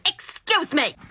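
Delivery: high, indignant call for attention; pushy, high-pitched interruption; demanding customer. Acted. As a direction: pipe up sharply over the whole line. Line-cutting customer demands cashier's attention.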